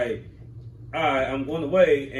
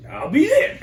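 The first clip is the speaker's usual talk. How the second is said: high, loud shout tipping into laughter; eager agreement; 12 semitones higher, 8 dB louder than usual. Retold or imitated. Driver told by cop to get there, eager shout. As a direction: blurt it out loud and high, delighted.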